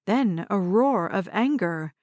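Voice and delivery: usual speaking voice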